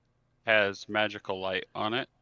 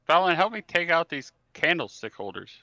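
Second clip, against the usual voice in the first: higher-pitched call to an ally; mildly raised yell for help; 6 semitones higher, 4 dB louder than usual. Acted. Player calls Fallon to help in battle, light yell. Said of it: "I'm gonna yell out".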